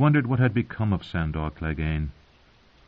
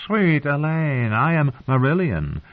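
high, drawn-out wooing voice; amorous singer; 7 semitones higher than usual. A narrator's reading. Singer introducing himself to the sleeping girl, high flowery wooing. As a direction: stretch the name out high and flowery.

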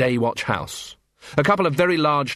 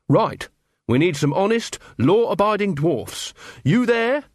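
brisk recruiting officer; 8 semitones higher than usual. Acted. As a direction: pitch climbing to a call. Carrot picks out recruits.

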